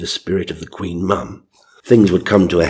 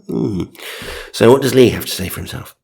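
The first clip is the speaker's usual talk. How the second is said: normal talking voice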